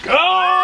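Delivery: startled shout. Real, not acted. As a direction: yelp as the egg hits. Egg smashed on his head.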